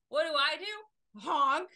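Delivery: loud, slow and high; fed-up driver stuck behind the stopped car; exasperated. Retold or imitated. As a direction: build up to the honk.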